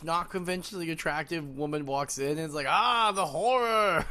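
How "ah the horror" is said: loud, high, drawn-out fake dismay; mock horror. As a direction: wail it in hammed-up horror. Mocks reaction to unattractive woman.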